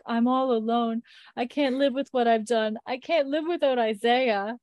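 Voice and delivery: a touch of melodramatic lament; mock despair. Voicing stunned, despairing Alicia.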